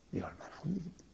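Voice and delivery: hushed, breathy aside to the congregation; whispered rhetorical question. Real, not acted. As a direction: lean in and whisper it. Preacher drops to a whisper.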